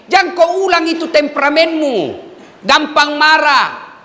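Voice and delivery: ordinary talk, nothing marked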